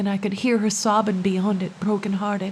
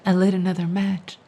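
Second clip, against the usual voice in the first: ordinary delivery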